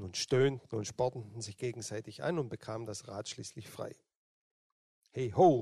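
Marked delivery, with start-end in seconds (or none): 5.1-5.6 loud, carrying call across the river; a hearty loud hey ho. A narrator's reading. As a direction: shout it to the others in the water. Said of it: "rief Eckbert noch einmal".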